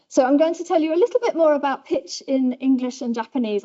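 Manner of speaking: ordinary talk, nothing marked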